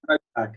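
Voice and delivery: low "My back", dropping at the end; falling intonation. Shown as a demonstration. Teacher corrects a student's last list item, falling intonation. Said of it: "It has to go down".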